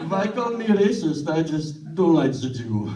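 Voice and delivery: a weak put-on German accent; terrible German accent. Comedian doing Hitler in a bad German accent. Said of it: "What a terrible accent".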